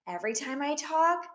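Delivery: normal talking voice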